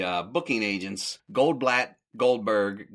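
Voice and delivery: ordinary talk, nothing marked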